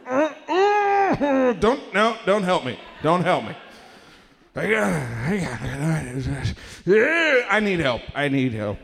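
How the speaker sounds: groaning effort of getting out of a chair; hammed-up elderly struggle; old guy straining. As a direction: strain and grunt like an old man heaving himself up. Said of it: "an old guy gets out of a chair".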